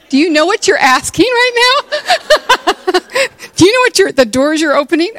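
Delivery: high, loud, comic disbelief; mock incredulity. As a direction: blurt it out in hammed-up astonishment. Incredulous reply to invitation to vent.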